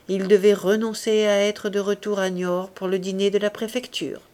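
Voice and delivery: ordinary delivery, nothing marked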